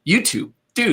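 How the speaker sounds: high-pitched, incredulous appeal; mock exasperated plea. Streamer complaining to YouTube about ads, mock exasperated. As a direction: protest in playful disbelief.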